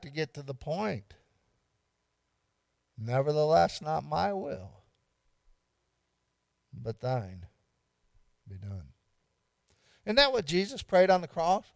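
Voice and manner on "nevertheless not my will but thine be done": prayerful hush. Acted. Quoting Jesus's prayer, fading.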